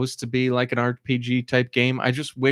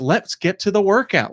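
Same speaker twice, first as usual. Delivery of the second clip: impatient; 9 semitones higher, 4 dB louder than usual. Retold or imitated. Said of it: "I'm like".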